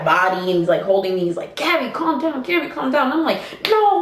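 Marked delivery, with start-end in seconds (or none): 1.5-3.1 urgent calming. Retold or imitated. Friend restraining her, acted quote. 3.7-4.0 high, hyped-up no; retold yelling. Woman held back by a friend, high mock yell. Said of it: "I'm yelling".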